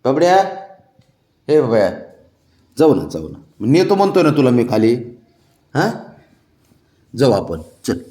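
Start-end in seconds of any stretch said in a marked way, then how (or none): none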